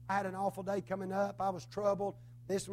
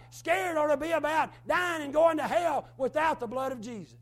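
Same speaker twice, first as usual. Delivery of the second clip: loud, rising sermon cadence, punching phrases; fervent preaching; 5 semitones higher, 5 dB louder than usual. Acted. Preacher warning his congregation about hell, in loud fervent preaching. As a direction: preach it to the pews at full force.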